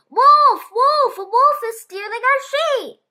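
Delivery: high, loud shout through cupped hands; yelling out. A narrator's reading. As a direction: call the alarm across the pasture. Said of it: "cupped his hands around his mouth and yelled".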